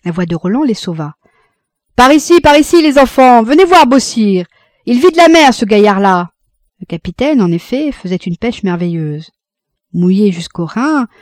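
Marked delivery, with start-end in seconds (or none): 2.0-6.3 high, loud hail across the rocks; calling out. Acted. Roland calls the couple over to see Beausire, loud and high. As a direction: shout to the others to come look.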